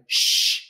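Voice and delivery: sustained "Shh" without voicing; sh sound demo. Shown as a demonstration. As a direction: model a long "Shh" for learners. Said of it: "I want you to say".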